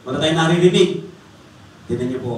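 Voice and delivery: normal talking voice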